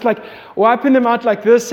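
ordinary talk

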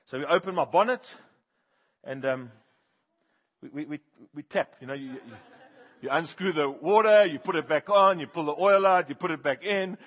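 ordinary talk

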